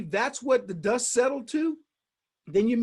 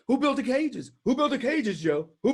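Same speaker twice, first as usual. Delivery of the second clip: higher, pressing Trump imitation; Trump impression; 3 semitones higher, 3 dB louder than usual. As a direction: hammer the question like Trump. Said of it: "Trump was like".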